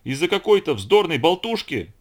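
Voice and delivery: loud, high, scornful outburst; acted furious shout; explosive anger. A narrator's reading. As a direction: explode with indignation. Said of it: "вдруг Бретт взорвался".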